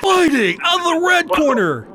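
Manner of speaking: loud, high ring announcer call; UFC announcer call. Retold or imitated. Host hamming up the UFC fighter introduction. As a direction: belt it out like the cage announcer. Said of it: "You gotta hear that fifteen times".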